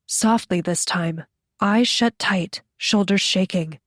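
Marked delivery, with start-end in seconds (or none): none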